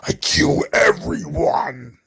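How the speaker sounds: slow, breathy giant's threat; mock Omos monster voice. Podcaster mimicking Omos's threat, slow hammed-up rasp. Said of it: "Omos starts yelling at the floor".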